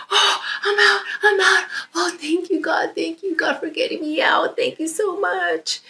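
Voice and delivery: high, crying with relief and joy; tearful joyful thanks. Acted. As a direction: sob out thanks to God, overjoyed. Frog escapes, thanks God.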